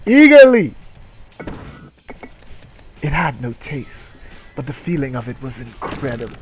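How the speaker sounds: ordinary talk, nothing marked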